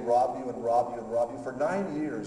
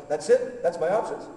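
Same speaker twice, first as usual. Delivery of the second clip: higher, louder cry of disbelief; incredulous; 6 semitones higher, 6 dB louder than usual. Retold or imitated. Pastor lying on the bedroom floor, crying out in disbelief to God. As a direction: protest the choice in shock.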